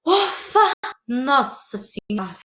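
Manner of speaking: relieved exhale sinking into slow words; relief. Shown as a demonstration. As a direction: let the tension out in a big sigh. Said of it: "com alívio".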